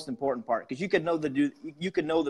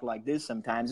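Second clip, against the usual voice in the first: lower, softer, subdued delivery; calm low-key voice; 4 semitones lower, 6 dB quieter than usual. Shown as a demonstration. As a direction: drop the energy right down. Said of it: "I got this elevated tone in my voice and I don't talk".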